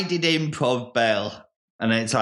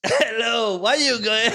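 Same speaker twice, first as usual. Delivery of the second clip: put-on a first stab at an Irish-sounding farmer accent, higher; 9 semitones higher than usual. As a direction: greet him as a rustic farmer in that accent. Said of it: "I've got the accent".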